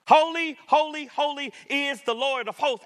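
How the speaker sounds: high, ringing proclamation with a loud first word; emphatic angelic praise. Acted. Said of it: "The angels are saying that God is so holy".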